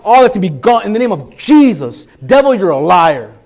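loud, high, driving sermon voice; preaching at full force. Acted. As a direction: preach it out, building to a cry. Pastor preaching to congregation.

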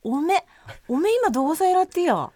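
high-pitched Akita dialect question. Pretend. Asking a classmate where they live now, Akita dialect. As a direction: quiz them in playful Akita dialect. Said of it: "ちょっと秋田弁で話してみたい".

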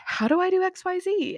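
imitating her own flustered asking; anxious new mom. Retelling her anxious questions.

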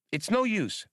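high, eager little droid voice; childish droid voice. Acted. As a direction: chirp it like a know-it-all kid robot. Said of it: "in his childish voice".

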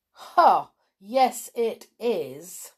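a loud grunt, then forced politeness, slowly; barely holding back his temper, polite through his teeth; strained politeness. A narrator's reading. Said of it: "as if he was being polite with great difficulty".

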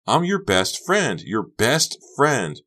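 high, loud, drawn-out protest; desperate insistence. A narrator's reading. Honey burning with anger at Becca, desperate insistence. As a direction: stretch the words out in desperation.

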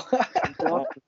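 high, slow, laughing plea. Pretend. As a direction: laugh through a sing-song plea.

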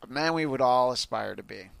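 ordinary delivery

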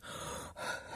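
voiceless acted gasp for air; breathless panting. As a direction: imitate struggling to breathe. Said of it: "I can't breathe".

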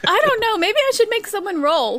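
amused. Real, not acted. Game master amused by the impression.

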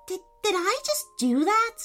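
high, stammered amazement; stunned disbelief. A narrator's reading. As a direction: stumble into the question, astonished. Boy stunned by his own lightning.

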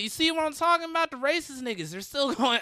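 high, loud call back to the crowd; imitating his own high stage shout; mock stage callout. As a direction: call out to the whole room, mock-exasperated. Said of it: "And I was like".

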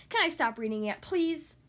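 ordinary delivery, nothing marked

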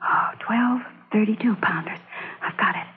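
hushed whisper. Acted. Spy to her companion, counting guns in a whisper. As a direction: breathe it so no one hears.

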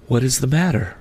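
high, gentle otherworldly questioner; ghostly spirit. Acted. The spirit's voice in narration.

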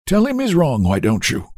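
high, aged grumble; weak complaint. A narrator's reading. Old-man baby complains to nurse, weakly. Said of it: "he complained in a weak voice".

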